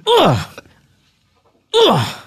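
high, loud effort grunts; strained hugging grunts. Acted. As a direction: grunt hard like squeezing a hug. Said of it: "you're humping during the hug".

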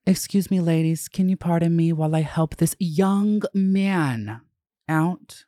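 high, sugary customer-service politeness; mock-polite saleswoman, pitched well up; overly pleasant clerk. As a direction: imitate her sweet-as-pie voice. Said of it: "so pleasant with them".

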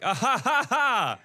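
high mocking fake laugh; hammed-up fake laughter. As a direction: cackle like a clueless friend. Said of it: "they're like".

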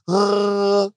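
high, held pretend gargling noise; a sustained throaty toddler imitation; mimicked baby gargle. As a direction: copy a baby's attempt at gargling. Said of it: "I gargle, and I hold her".

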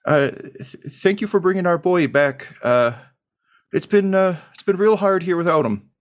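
high, teary thanks; tearful mom. Acted. Crying mom thanks the cleric for her son. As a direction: thank him through tears, voice up. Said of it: "she's crying again".

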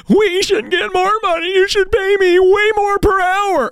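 very high, put-on complainer's voice; mocking snowflake. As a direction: mimic an entitled complainer in falsetto. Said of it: "all these snowflakes who don't understand capitalism go".

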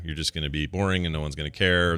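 usual speaking voice

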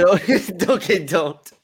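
laughing protest. Real, not acted. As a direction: giggle through the refusal. Laughing refusal to teasing.

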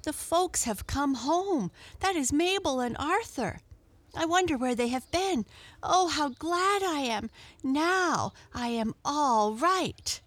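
high, excited story-cat voice; exclaiming cat. A narrator's reading. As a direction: exclaim high with happy relief.

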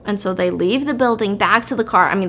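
ordinary delivery

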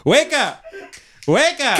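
very high, hammed-up yell; exaggerated high-pitched hollering; mock shouting. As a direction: jolt them awake with a silly high shout. Acting out over-excited podcasters.

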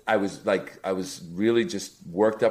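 ordinary delivery

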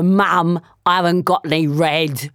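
usual speaking voice

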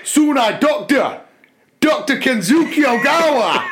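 put-on high, lilting Japanese-accented voice, a bit fast. Reading Kenzuki aloud to the class, in a high hammed-up Japanese accent. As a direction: read Kenzuki with a heavy comic Japanese accent, barely holding in laughter. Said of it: "there was a bit where he's like".

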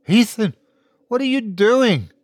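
high, urgent cry of disbelief; alarmed. A narrator's reading. Terrified woman to the man she loves, high alarmed cry.

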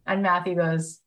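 normal talking voice

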